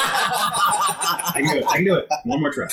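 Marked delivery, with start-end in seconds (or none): 0.0-1.6 laughing. Real, not acted. Laughing self-encouragement.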